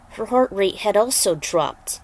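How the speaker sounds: plain reading voice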